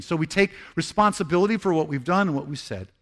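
normal talking voice

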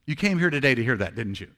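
normal talking voice

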